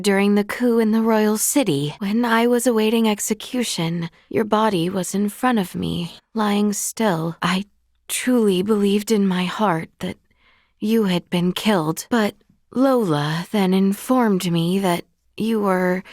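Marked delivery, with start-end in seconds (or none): none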